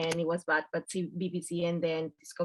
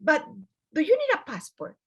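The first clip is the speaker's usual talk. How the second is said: surprised, much higher question; 7 semitones higher, 4 dB louder than usual. Retold or imitated. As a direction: ask in disbelief. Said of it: "And it's like".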